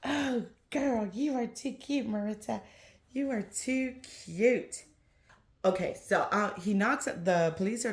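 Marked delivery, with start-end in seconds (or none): 0.0-4.8 higher, sing-song cooing to the doll. Real, not acted. Mom coos to her reborn doll Marita, sing-song baby talk.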